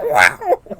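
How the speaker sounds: squealed high, loud mock "Wow!"; a hammed-up high yelp of surprise. Queen slammed into ceiling, hammed-up yelp.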